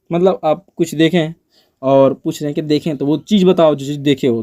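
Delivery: ordinary talk, nothing marked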